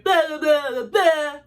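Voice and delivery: mocking string of blahs; dismissive. Waving away boyfriend's excuse about the pink ring, dismissive. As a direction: rattle off his excuses as empty noise.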